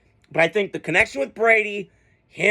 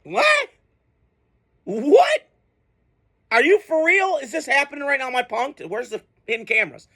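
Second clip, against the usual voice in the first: stunned disbelief; 5 semitones higher than usual. Retold or imitated. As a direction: act floored by the news, pitch shooting up. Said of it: "That's what I felt like tonight".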